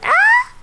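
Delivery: put-on piercing high-pitched girly scream; short shrill girly shriek; girly scream demo. Shown as a demonstration. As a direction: shriek like a little girl. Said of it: "Like that".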